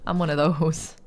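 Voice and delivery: ordinary talk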